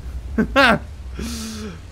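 a burst of delighted laughter; real happy laughter; gleeful laugh. Real, not acted. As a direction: laugh at the perfect landing. After the landing.

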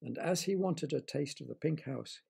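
ordinary delivery

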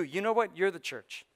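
ordinary delivery

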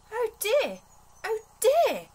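high, loud dismayed oh dear; amazed. Acted. Teddy finds Grandma's cottage gone, loud high dismay. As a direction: cry out in dismay, voice leaping up. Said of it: "said Teddy, amazed".

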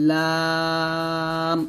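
লাম with a very long, thin aa on one note; sustained lam. Shown as a demonstration. Teacher demonstrating lam for learners, long and level. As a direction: draw লাম out slowly and evenly. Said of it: "এরকমভাবে উচ্চারণ করবেন".

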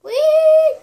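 excited whee. Pretend. Character dives in game.

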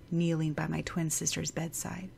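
plain reading voice

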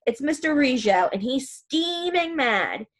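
usual speaking voice

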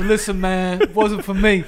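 mock talent-show judge; Randy. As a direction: deliver a judge's casual rejection. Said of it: "cut out the Randy impression".

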